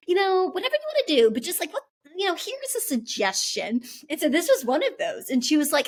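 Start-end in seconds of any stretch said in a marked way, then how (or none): 0.1-3.8 higher, fast, breezy mock mom; mother casually offering a suggestion, in a higher, fast imitated voice; imitating mom. Mother floating a wedding idea to her daughter, higher and fast. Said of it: "She's just like".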